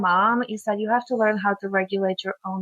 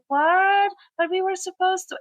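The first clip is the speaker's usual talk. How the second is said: imitating a let-down child, much higher; a kid's higher "What?" of disappointment; disappointed kid; 10 semitones higher than usual. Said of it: "they're like".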